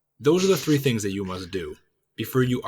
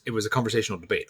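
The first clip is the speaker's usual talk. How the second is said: ordinary delivery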